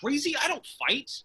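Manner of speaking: high, squeaky fright; scared guy refusing to fight; panicked. Acted.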